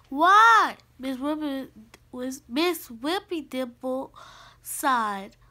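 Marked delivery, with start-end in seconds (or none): 0.1-0.8 put-on loud, higher, pestering kid's question; insistent questioning. Kitten pestering his teacher after a non-answer, insistent. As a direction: press harder for a reason.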